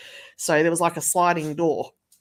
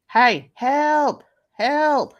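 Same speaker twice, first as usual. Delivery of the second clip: mock cry for help; 9 semitones higher, 8 dB louder, much slower than usual.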